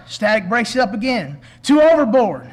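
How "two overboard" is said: mayday radio call. Acted. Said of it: "spoken in a moment of terror".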